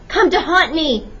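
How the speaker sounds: loud, high-pitched outcry; shouted accusation. A narrator's reading. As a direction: shout it at a ghost. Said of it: "Colonel Bowie shouted".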